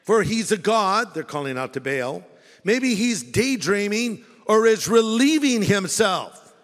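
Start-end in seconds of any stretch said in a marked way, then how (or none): 0.0-1.1 a lightly higher teasing jeer; mocking taunt. Elijah mocking Baal's prophets with a light jeer. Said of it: "He started mocking them". 2.6-6.5 drawn-out teasing jeer, lightly higher; slow mocking taunt. Elijah taunting Baal's worshippers with slow mock. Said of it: "Elijah began mocking them".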